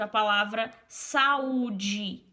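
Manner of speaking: "saúde" said slowly, the vowel sounds held apart; slow demo word. Shown as a demonstration. Teacher showing a hiatus to Denis, slow and careful. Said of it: "Saúde é S A U D E".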